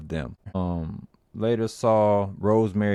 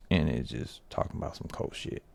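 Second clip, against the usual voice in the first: whispered aside; 7 semitones lower, 8 dB quieter than usual. Real, not acted. Whispered aside about creepy cult.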